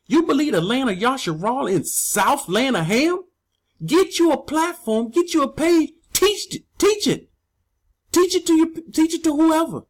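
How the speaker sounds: exasperated wife telling critics to teach it elsewhere; quoting his wife. Retold or imitated. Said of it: "My wife said".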